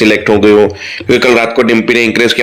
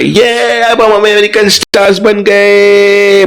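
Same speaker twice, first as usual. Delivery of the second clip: much higher, slow, squealing with delight; giddy joy; 9 semitones higher, slower than usual. Acted. Anupama squealing to herself about American stars, high and delighted. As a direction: voice soaring with childish glee. Anupama dreams the critics' praise.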